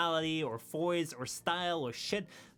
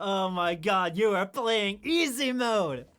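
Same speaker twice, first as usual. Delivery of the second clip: loud, higher, stretched-out mock complaint; mock exasperated outburst; 3 semitones higher, 7 dB louder, much slower than usual. Player to silent lobby mate, mock exasperated shout. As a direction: call them out loudly, dragging the words.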